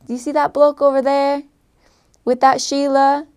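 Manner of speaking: imitated Australian speaker; Australian accent. Shown as a demonstration. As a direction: put on Aussie slang talk. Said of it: "they would call boys blokes".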